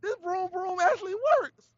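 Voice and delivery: high mock astonishment; high, wide-eyed surprise; pleasantly shocked. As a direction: gush that it actually works.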